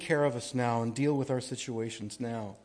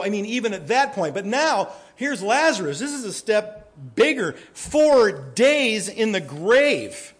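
loud, raised preacher's voice building to a stretched-out climax; emphatic preaching; 6 semitones higher, 8 dB louder than usual. Real, not acted. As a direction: preach it loud and high, stretching the last words.

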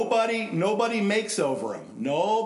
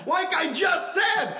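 slow, high, loud preacher's cry; preacher's shout; 4 dB louder, slower than usual. Retold or imitated. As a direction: fling it back at the gamblers. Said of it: "he said right then".

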